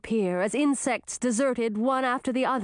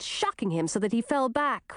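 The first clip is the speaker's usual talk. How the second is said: ordinary delivery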